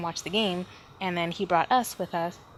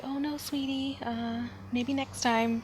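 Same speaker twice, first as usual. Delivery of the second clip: sweet parent; 4 semitones higher, slower than usual. Retold or imitated. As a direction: coo it like a gentle mom. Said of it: "He doesn't say".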